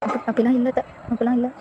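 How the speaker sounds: soft, hurt little protest; quietly deny, holding back tears; meek denial. Acted. Wife softly denying mother-in-law's accusation.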